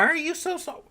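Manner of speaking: very high-pitched, hammed-up tearful whine; put-on weepy falsetto; mock crying. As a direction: squeal it as if about to burst into tears. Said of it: "I am about to cry".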